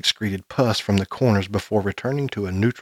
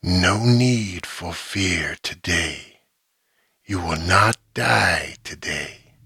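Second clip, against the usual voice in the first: low, slow, buzzing monster hiss; slow eerie creature rasp; deep buzzy creature voice; 2 semitones lower, slower than usual. A narrator's reading. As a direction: drag each word out like something inhuman. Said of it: "it hissed in a deep, buzzy voice".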